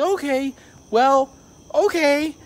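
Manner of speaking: very high, loud, halting agreement; retold uneasy acceptance. Worker giving in to the old lady, uneasy high voice. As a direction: squeak it out as you back off.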